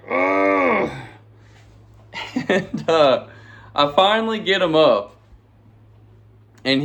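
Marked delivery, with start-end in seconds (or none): 0.1-0.9 high straining grunt of effort; effortful heave sound; strained grunt. Retold or imitated. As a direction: grunt like hauling a heavy friend up. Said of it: "And I'm like".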